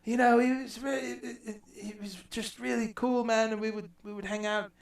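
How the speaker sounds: imitating [name]. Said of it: "he goes".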